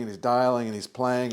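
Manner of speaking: usual speaking voice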